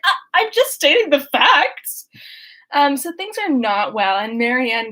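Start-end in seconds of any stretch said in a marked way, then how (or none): 0.0-2.1 defensive Eleanor. Retold or imitated. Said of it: "And Eleanor's like".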